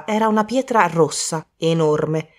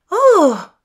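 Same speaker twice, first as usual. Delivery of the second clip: loud, high-pitched cry of surprise; exclamation; 13 semitones higher, 7 dB louder than usual. Acted. Bridget spots the find on Poirot's plate, exclaiming. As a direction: burst out with a high "Oh!". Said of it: "esclamò Bridget".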